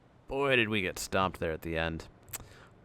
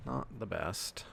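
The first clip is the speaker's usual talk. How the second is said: "Not the best" muttered far softer; quiet echo; 9 dB quieter than usual. Real, not acted. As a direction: drop "Not the best" almost under the breath. Much quieter repeat.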